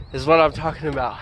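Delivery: ordinary delivery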